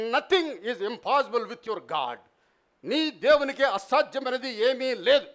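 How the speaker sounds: normal talking voice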